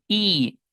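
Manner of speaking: I held as a high "ee"; long I. Shown as a demonstration. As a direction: stretch I with smiling lips. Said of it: "I larga".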